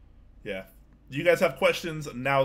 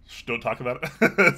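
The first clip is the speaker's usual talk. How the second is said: laughing; 8 dB louder than usual. Real, not acted. Laughing after joking shush.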